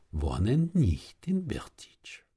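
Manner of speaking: soft, confidential clincher; hushed revelation. Acted. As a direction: drop suddenly to a near-whisper. Punchline of old man's argument.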